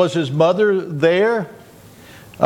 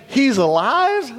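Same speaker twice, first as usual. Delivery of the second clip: high, drawn-out disbelieving question; incredulous governor; 5 semitones higher, slower than usual. Retold or imitated. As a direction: query it slowly in astonishment. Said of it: "imagine the governor saying".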